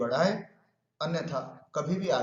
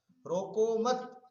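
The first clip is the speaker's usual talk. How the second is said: "रोको मत" said as one drawn-out, higher phrase; pause after मत; 5 semitones higher, much slower than usual. Shown as a demonstration. Teacher showing students a shift in meaning, pause after मत. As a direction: join मत onto रोको, then break.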